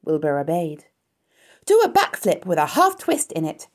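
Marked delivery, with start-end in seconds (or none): 1.7-3.7 loud, high excited command; crying out. A narrator's reading. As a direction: cry out the next trick. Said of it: "cried Charlotte".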